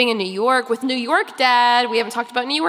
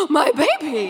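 mock panicked queen; 2 semitones higher than usual. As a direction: gasp it out like a melodramatic mother. Queen Amber panics about curse.

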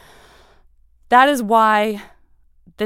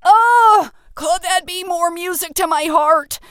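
overjoyed; 10 semitones higher, 8 dB louder, slower than usual. Real, not acted. Moved by a listener email.

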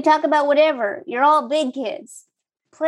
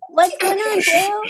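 put-on baby voice; 6 semitones higher, slower than usual. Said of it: "Can you use a baby voice?".

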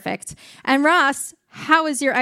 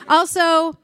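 normal talking voice

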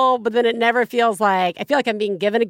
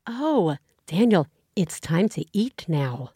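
lightly voiced stern mom; a hint of an annoyed mother; 5 semitones lower, 3 dB quieter than usual. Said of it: "you're really good at doing the mom voice".

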